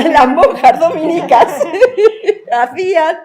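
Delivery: real laughter breaking through, loud and high; laughing. Real, not acted. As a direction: tell it through a fit of giggles. Laughs while telling about the nuns. (entre risas)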